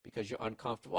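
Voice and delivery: ordinary talk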